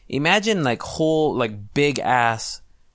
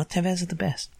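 catchy slogan; 7 semitones higher, 5 dB quieter than usual. Shown as a demonstration. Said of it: "super catchy in my head".

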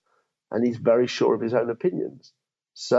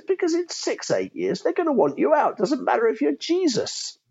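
higher-pitched mock posh lawyer; a hint of a posh partner giving a warning. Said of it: "in a very posh voice".

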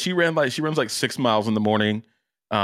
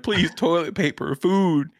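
mock panicked plea; 7 semitones higher, slower than usual. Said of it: "They're panicking".

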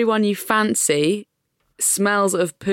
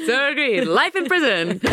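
laughing; 7 semitones higher than usual. Pretend. Laughing mock sentencing verdict.

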